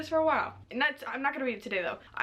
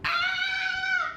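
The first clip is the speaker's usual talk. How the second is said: very high loud squeal of joy; high fangirl squeal; excited squeal; 4 dB louder than usual. Real, not acted. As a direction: let out a thrilled shriek. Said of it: "So I'm so excited".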